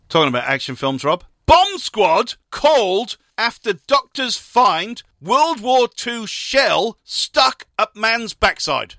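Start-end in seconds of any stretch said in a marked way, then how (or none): 1.5-8.9 high, slow, punched-out headline read; headline announcement. Acted. Co-host springing a bizarre headline on Rob, announcer style. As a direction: announce it with big dramatic emphasis.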